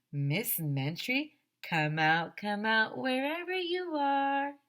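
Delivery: slow, climbing hide-and-seek call; drawn-out sing-song search call. A narrator's reading. Calling the hiding woman.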